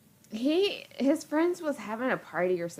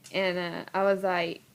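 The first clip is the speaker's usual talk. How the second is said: ordinary talk, nothing marked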